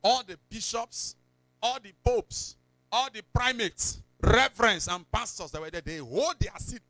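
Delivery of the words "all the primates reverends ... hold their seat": ordinary talk, nothing marked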